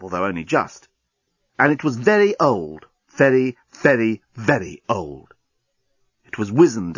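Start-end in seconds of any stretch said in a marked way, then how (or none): 1.6-5.3 "very" repeated, slowing and fading; stress on very. A narrator's reading. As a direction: stretch out "very, very, very old". Narrator stressing great age.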